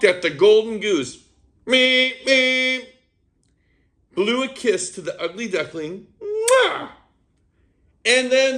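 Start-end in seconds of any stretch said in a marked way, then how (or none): 1.6-2.9 high squeaky horn beeps; car horn meep. Acted. The princess honks at the golden goose, squeaky beeps. 6.2-6.9 big high smacking kiss; kiss sound. Acted. Blowing a kiss to duckling.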